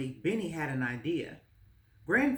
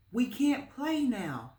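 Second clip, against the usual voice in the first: higher, slow gentle scold of the dog; a boy telling his dog off, slowly; boy scolds his runaway dog, high and slow; 8 semitones higher, slower than usual. Acted. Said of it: "he scolded".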